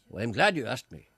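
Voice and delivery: ordinary delivery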